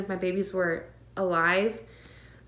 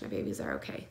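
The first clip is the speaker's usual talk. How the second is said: barely voiced whisper of relief; relieved whisper; 10 dB quieter, faster than usual. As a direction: breathe out the words in disbelief. Relief hearing two heartbeats.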